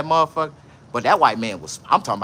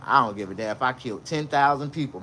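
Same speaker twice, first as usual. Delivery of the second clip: ordinary talk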